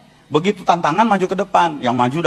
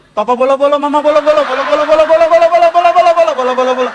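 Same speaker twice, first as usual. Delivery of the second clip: higher, louder bolo bolo gibberish chant; hammed-up mock glossolalia building in volume; funny tongues imitation; 4 semitones higher, 5 dB louder, faster than usual. Preacher mocks the ladies' odd tongues, loud and higher. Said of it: "bahasa lidahnya lucu".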